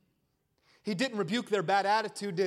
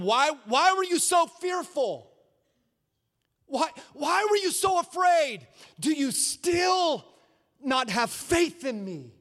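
stern rebuke; 6 semitones higher than usual. Acted. Jesus rebuking the disciples.

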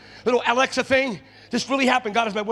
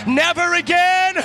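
"never again" louder, higher, slow, "again" stretched; louder shouted declaration; 8 semitones higher, 8 dB louder, slower than usual. Shown as a demonstration. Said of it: "tell them, never again".

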